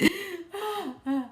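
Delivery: breathy high laugh; soft high giggle. Real, not acted. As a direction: giggle quietly to yourself. Laughing after oh my gosh.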